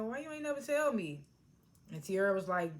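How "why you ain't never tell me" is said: higher-pitched retold complaint; imitated reproach. Mother asks daughter about the secret, high mock reproach. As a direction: lift the voice to mimic her asking.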